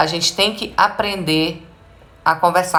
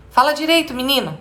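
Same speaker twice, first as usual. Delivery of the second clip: higher-pitched mock scolding; scolding parent; 8 semitones higher than usual. Parent correcting a boy's jumbled speech, mock scolding. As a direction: imitate a parent correcting a child. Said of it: "o que eu vejo acontecer".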